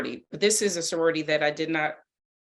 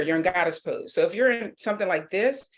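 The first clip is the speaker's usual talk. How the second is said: normal talking voice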